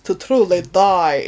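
slow vampire drawl; Dracula impression. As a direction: draw it out like Dracula. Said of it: "somebody else does it".

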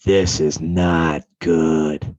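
very slow, low dread. Retold or imitated. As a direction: let it sink in slowly. Dread after chief's threat.

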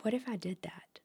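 hushed tempted whisper; whispered thought. Retold or imitated.

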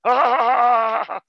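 mocking sheeple who laugh at references; dim audience. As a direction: play a clueless viewer cackling. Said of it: "sheeple are like".

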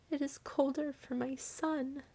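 put-on high, soft grieving old woman; tearful. A narrator's reading. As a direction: say it softly, high, on the edge of weeping. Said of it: "and wept afresh".

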